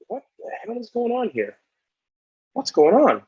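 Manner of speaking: high-pitched, baffled demand for answers; bewildered. Retold or imitated. Player asks teammate what is happening, bewildered. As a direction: ask it in retold alarm, voice pitched well up.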